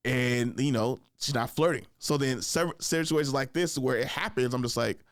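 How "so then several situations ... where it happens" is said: normal talking voice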